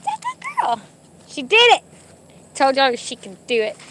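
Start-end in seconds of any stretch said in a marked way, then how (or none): 0.0-1.8 tiny high squeal of pride; delighted praise. Real, not acted. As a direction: squeak with joy at the dog. Praising dog's success.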